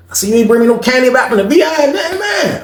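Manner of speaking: high, playful needling; high mocking jab; teasing Jeff. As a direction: tease him in front of people.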